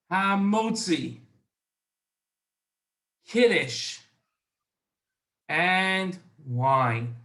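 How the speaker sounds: each word alone with long pauses between; slow dictation, a long pause after every word; slowly. Shown as a demonstration. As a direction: say each word slowly so kids can write it down. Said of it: "Let me say it again slowly".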